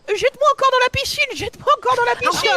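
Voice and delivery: voix d'enfant très aiguë qui supplie; cousin surexcité. Retold or imitated. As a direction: imite un gamin tout excité à la piscine. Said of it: "ton cousin qui dit". (voix aiguë de gamin qui réclame encore)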